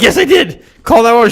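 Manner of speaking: loud, high desperate insistence; insistent yell. Retold or imitated. Insisting to his girlfriend, high desperate yell. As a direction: yell it so she believes you.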